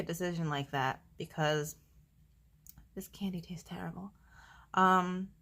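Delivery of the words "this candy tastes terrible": whispered aside. Real, not acted. Aside about the candy.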